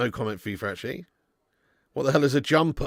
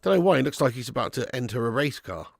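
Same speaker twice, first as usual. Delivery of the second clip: ordinary talk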